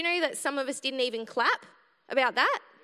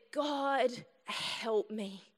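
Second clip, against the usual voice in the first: faint, softer plea in pain; quiet plea; 6 dB quieter, much slower than usual. Retold or imitated. In the car with stomach pain, a softer quiet plea. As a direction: murmur it as a desperate prayer. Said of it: "And I just said".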